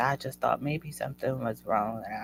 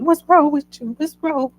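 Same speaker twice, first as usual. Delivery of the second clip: high, loud worried mom voice; imitating mom's alarmed question; mom asking; 14 semitones higher, 9 dB louder than usual. Mom demanding why she's crying, high and loud. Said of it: "My mom goes".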